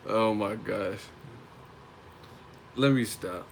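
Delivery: ordinary delivery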